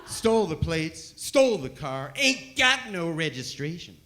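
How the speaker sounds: put-on Southern cop on the radio, in a hillbilly accent. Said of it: "biggest hillbilly accent I'd ever heard".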